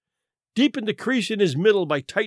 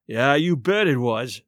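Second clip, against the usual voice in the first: a lower, groaning reply; 5 semitones lower than usual. A narrator's reading. Captain recalling their failed dinner, groaning. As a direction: let it come out as a groan. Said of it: "groaned the captain".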